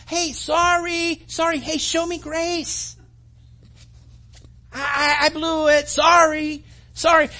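a touch of sheepish pleading; sheepish apology. Retold or imitated. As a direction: beg the other driver for grace. Apologizing to honking driver.

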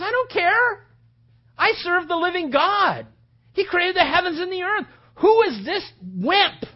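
high, loud, ringing declaration; defiant David. Acted. Young shepherd defies his brothers' warnings about the giant, defiant shout. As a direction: shout down their fears, stretching the last taunt.

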